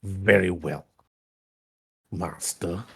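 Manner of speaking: slow, low, hushed submission; low villain servant voice; grudgingly obedient Sith. Acted. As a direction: yield quietly, trailing very soft on the title. Haunt yields to Scourge in character.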